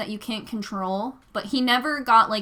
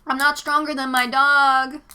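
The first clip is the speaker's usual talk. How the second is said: louder, as if calling across a street; mildly raised voice, re-enacting a warning yell; retold yell; 3 semitones higher, 6 dB louder than usual. Dog walker warning an approaching dog owner, retold yell. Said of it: "Like, I yelled that".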